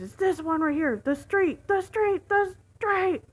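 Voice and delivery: high, loud frantic repetition; retold freak-out shouting; yelling. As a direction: mimic a passenger yelling directions, escalating. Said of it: "he's yelling at me at this point".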